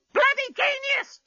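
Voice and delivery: very high, slow squawking parrot. A narrator's reading. Parrot answering the captain, squawking very high. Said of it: "screamed the parrot".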